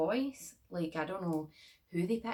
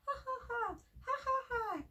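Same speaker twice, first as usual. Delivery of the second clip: very high, mocking ha ha ha; a badly acted evil doll cackle; creepy doll laugh. Vlogger's doll cackles at its victims, very high. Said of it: "shit acting from that doll".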